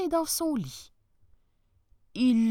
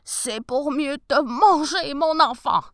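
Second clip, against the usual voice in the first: wolf voice; 6 semitones higher, 8 dB louder than usual. Acted. Wolf reveals himself.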